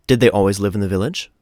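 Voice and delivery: ordinary delivery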